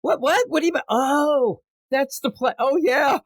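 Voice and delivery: high-pitched put-on confusion turning to realisation; mock-puzzled high voice; imitating her catching on. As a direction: play her cottoning on, voice way up. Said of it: "I like when she goes like".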